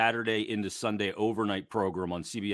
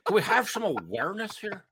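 mock exasperation; 12 semitones higher than usual. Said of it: "I felt like Nahagian".

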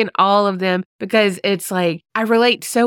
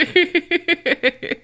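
laughing; 7 semitones higher than usual. Real, not acted. Laughing at friend's joke.

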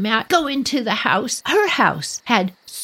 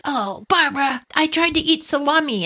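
put-on higher-pitched voice for a childhood friend; Kathy's voice; 7 semitones higher than usual. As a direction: lightly imitate a kid reporting trouble. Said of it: "And Kathy goes".

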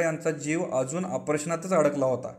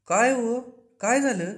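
high-pitched, short questions; concerned question; 7 semitones higher than usual. A narrator's reading. Janubhau questions angry Aba, high and concerned. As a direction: ask high, wanting to know what happened. Listener reacts to Aba's angry complaint.

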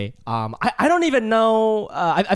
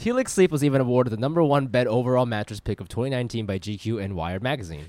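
smooth sponsor read voice; 3 dB quieter than usual. Acted. Host reading the mattress sponsor ad to his guest. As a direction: sell the mattress plainly and brightly.

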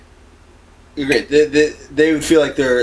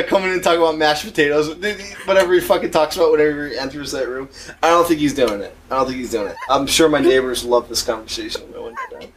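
ordinary talk

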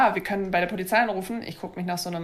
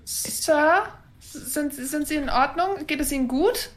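concerned call; 7 semitones higher, slower than usual. Acted. Calling to a crying stranger.

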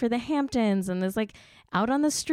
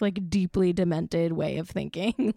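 ordinary talk, nothing marked

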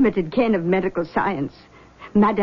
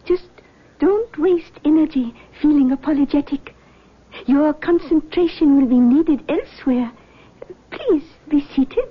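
lightly voiced mystic, higher pitch; seance medium; 6 semitones higher than usual. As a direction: greet them like a gracious clairvoyant. Medium reassures girl.

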